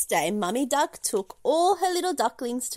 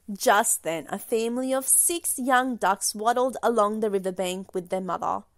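usual speaking voice

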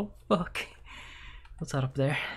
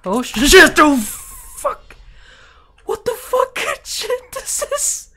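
panicked high shrieks, much louder; terrified screaming; 12 dB louder, much slower than usual. Real, not acted. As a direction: scream in real terror. Terrifying scare in game.

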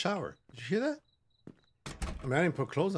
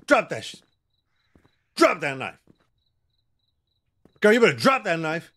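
louder, firm warning; 5 dB louder than usual. Real, not acted. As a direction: tell her to drop it now. Warning the armed girl.

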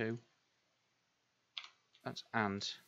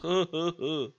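loud, higher mock laugh; 5 semitones higher, 7 dB louder than usual. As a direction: laugh at your own stupidity. Mock laugh at own blunder.

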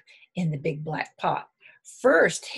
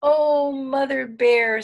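high, loud, drawn-out exclamation; storybook cub's happy amazement; delighted surprise; 7 semitones higher, 9 dB louder, slower than usual. A narrator's reading. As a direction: gasp of joy at the cake, voiced big. Little Bear sees surprise cake.

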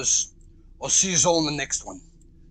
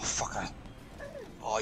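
normal talking voice

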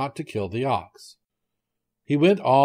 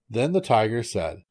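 plain reading voice

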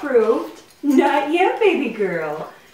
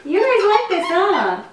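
laughing; 7 semitones higher than usual. Real, not acted. Playing with dogs and toy.

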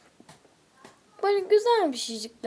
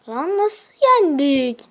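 slow, louder stunned question; amazed disbelief; 3 semitones higher, 9 dB louder, slower than usual. Real, not acted. To viewers after an unlikely win, slow astonished exclaim. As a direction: draw out the disbelief.

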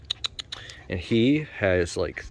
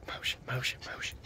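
whisper; 14 dB quieter, slower than usual. Real, not acted. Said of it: "I'm screaming".

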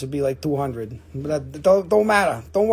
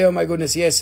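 normal talking voice

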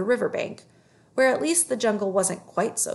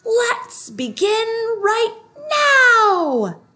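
much higher, much louder, slow roaring tigers; 14 semitones higher, 10 dB louder, much slower than usual. A narrator's reading. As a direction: roar it out as a pack ready to pounce. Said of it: "all the tigers said together in a loud roar".

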